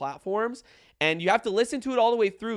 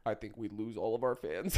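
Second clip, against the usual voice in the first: lower, softer, still in the deep voice; 8 semitones lower, 7 dB quieter than usual. Shown as a demonstration. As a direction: keep the put-on low voice. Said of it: "talking like this".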